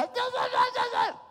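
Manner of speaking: put-on high shrill nagging kid; old bratty voice. Carlita's old voice before she changed, high and bratty. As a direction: rattle it off like a bratty girl. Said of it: "su vocecita había cambiado, ya no era".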